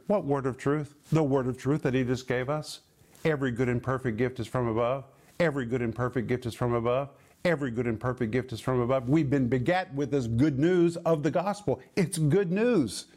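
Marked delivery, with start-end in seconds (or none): 3.2-9.1 fast, low recitation of the verse; quick, low rote drill; rapid repetition. Shown as a demonstration. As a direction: rattle it off in a lower voice. Verse drilled three times in succession.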